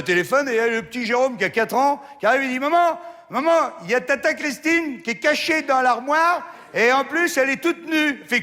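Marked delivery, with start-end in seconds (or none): 2.6-8.2 put-on high excited child tattling; little boy voice. Little Jérôme telling his mother, in a high child's voice. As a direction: pitch it up like a four-year-old.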